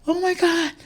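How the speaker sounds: high, loud cry of joy; overjoyed Oscar winner shrieking; ecstatic winner. Retold or imitated. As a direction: shout it like you just won the Oscar. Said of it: "sobe lá no palco com o Oscar na mão e fala".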